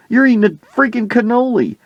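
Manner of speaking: very high-pitched mock outrage; incredulous scolding. Player scolding his coach about a cannoli, incredulous. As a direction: squeal it in exasperated disbelief.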